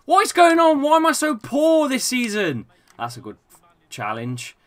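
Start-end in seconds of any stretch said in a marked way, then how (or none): 0.1-2.7 high, loud exasperated complaint; exasperated outburst. Real, not acted. Gamer despairing at this season's form, exasperated. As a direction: cry out in real frustration.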